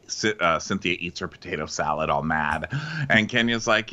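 ordinary delivery

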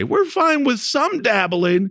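mock citizen voice. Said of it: "fifty-two percent say".

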